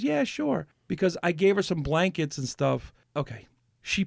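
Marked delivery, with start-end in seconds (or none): 0.0-0.7 much higher, casual woman's reply; cat lady voice. Retold or imitated. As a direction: toss it off lightly, higher. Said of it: "She goes".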